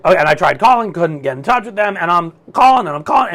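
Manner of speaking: usual speaking voice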